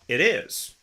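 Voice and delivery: ordinary delivery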